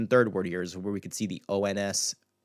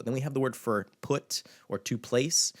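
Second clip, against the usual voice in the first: ordinary talk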